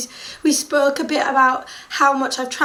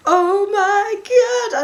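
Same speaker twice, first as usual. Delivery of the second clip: sing-song exclaim; 7 semitones higher, 4 dB louder, slower than usual. Retold or imitated. Fan meets drummer.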